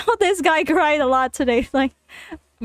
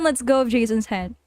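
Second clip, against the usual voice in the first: ordinary talk, nothing marked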